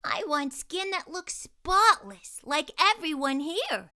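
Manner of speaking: put-on wistful young girl asking for a wish; Jessie's wish. Jessie telling the fairy her wish, girlish voice. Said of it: "Jessie wished".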